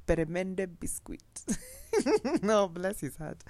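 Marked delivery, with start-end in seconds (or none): 1.3-2.4 high laugh. Real, not acted. Laughs at pet name.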